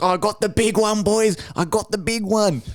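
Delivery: winner's glee. Retold or imitated. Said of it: "And he's like".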